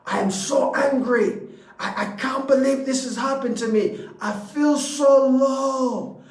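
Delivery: acting out someone wallowing in misery; emphatic complaint. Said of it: "if you keep emphasizing".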